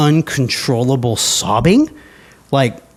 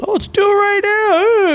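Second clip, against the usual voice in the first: far higher, silly keen voice; mock overeager reply. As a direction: play the clueless guy who shouts it out. Mocking an eager wrong reply.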